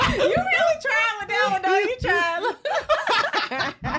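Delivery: high, loud laughing teaser. Real, not acted. Teasing the guest about her accent, laughing. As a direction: laugh through the words while teasing.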